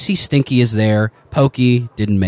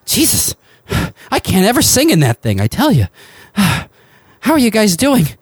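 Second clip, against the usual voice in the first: Joshua in character; 12 semitones higher than usual. Acted. GM voicing Joshua to the players, high and weary. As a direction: pitch it up and sigh it out. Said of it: "He's like".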